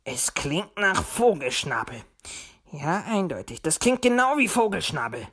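puzzled fox thinking aloud; verwundertes Murmeln. A narrator's reading. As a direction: muse to yourself, mildly puzzled. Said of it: "murmelte er verwundert". (verwundert vor sich hin sprechend)

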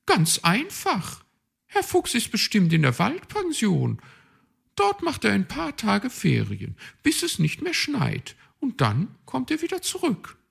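chirpy, self-assured magpie; high magpie voice. A narrator's reading. Magpie explains her plan, high bossy voice.

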